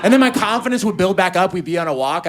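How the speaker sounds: ordinary talk, nothing marked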